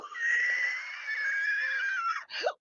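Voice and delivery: squealing laugh, very high and airy; a breathless high-pitched shriek of laughter. Real, not acted. Streamer cracking up at a chat comment, shrieking laugh. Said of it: "[name]'s got laughing".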